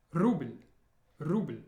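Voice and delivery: \"ruble\" dengan L lembut di depan mulut; Russian soft L. Shown as a demonstration. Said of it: "the L before is soft".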